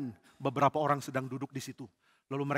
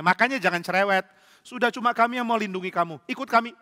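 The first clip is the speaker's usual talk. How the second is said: loud, raised, impatient telling-off; bossy scolding; 5 semitones higher, 5 dB louder than usual. Acted. Bandit ordering the fussy priest to follow, scolding. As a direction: scold him and take charge.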